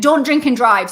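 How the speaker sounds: loud mock shout of a warning. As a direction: bellow it like someone yelling after you. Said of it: "someone shouting".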